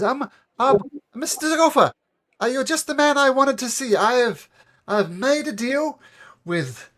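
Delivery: high, loud, fast stammering halfling; panicked halfling. Acted. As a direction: gabble excuses to the loan shark. Halfling voice again.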